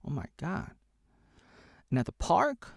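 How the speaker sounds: usual speaking voice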